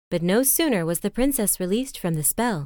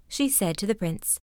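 usual speaking voice

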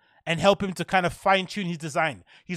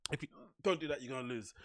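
softer, lower repeat; quiet echo; 4 semitones lower, 12 dB quieter, faster than usual. Shown as a demonstration.

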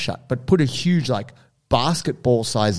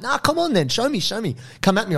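high-pitched mock bravado; goading; 10 semitones higher than usual. Bystander lures aggressor away from old man, goading. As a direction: dare him to come, pitch jumping up.

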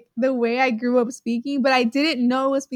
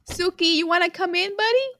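high sing-song call, rising at the end; coaxing a pet; 6 semitones higher than usual. Real, not acted. Co-host calling the cat inside, high coaxing. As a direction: coax a pet sweetly, pitched up.

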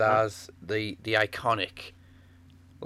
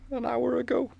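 much higher, mock tearful confession; breaking down. Grieving daughter confessing to the priest, higher, mock breaking down. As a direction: squeeze it out like a mother cracking. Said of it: "so she breaks down".

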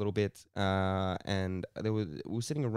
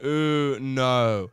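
drawn out, much louder ooh no; loud mock refusal; 3 semitones higher, 9 dB louder than usual. Retelling the crush turning him down, louder. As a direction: belt the girl's rejection. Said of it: "She went".

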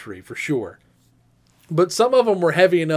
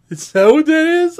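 high, louder mock puzzlement; Sarah teasing, higher and louder; 11 semitones higher, 5 dB louder than usual. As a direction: feign confusion, higher. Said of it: "And Sarah goes".

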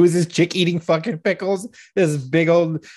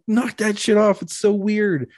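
higher-pitched mock disgust; retold exasperated order; 7 semitones higher than usual. Parent telling daughter off over ASMR, exasperated. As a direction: act out putting your foot down.

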